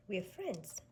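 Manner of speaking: plain reading voice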